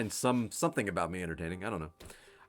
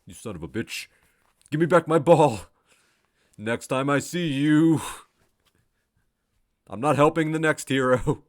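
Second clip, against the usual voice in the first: loud, higher mock-indignant rant; angry monk voice; 5 semitones higher, 8 dB louder than usual. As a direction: rant like a robbed old monk. Monk rants about his ball.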